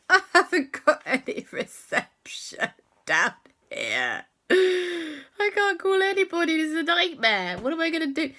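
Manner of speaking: put-on a child fretting that nobody can be called; panicky boy. To his friends, a high panicked child voice. Alexander panics, no phone reception.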